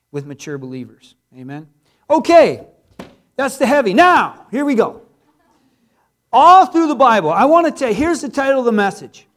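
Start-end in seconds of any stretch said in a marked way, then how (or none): 2.1-9.3 preaching at full force. Real, not acted. Pastor shifts into preaching.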